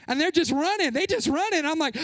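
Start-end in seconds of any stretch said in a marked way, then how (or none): none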